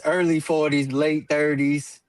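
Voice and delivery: normal talking voice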